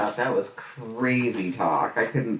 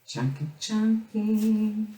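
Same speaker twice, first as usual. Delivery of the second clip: high, slow singsong "Junky, junky"; 9 semitones higher, 3 dB quieter, much slower than usual. Pretend. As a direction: croon the word like a playful tune. Delighted at junky paper.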